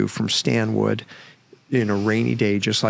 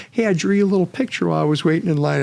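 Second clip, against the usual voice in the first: higher, quick, lightly imitated cheerful voice; cheery, eager quote; 7 semitones higher, much faster than usual. Man in wheelchair offers therapist his drawing, imitated cheerily. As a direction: imitate an eager, friendly hello, pitched up and hurried.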